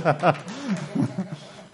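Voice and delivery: high, rolling laughter; laughter trailing away; real laugh. Real, not acted. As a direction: keep laughing after the punchline. Laughs after telling the anecdote.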